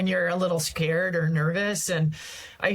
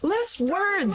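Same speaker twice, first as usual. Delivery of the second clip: repeats it higher and sharper, exasperated; exasperated boss; 12 semitones higher than usual. Retold or imitated. Mentor repeating his advice to her, now exasperated. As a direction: mentor losing patience, more exasperated. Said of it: "He goes".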